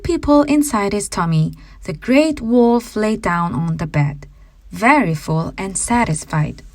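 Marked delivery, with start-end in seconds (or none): none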